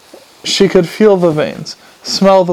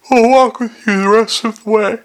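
lightly voiced higher character; 6 semitones higher than usual. A narrator's reading. Carrot offers to walk the golem home, in a higher voice. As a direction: lift the pitch for the offer.

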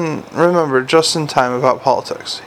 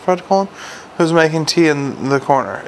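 ordinary delivery, nothing marked

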